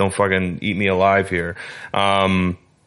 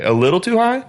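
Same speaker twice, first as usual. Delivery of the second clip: incredulous rising question; 13 semitones higher, faster than usual. Real, not acted. Disbelief at price verdict.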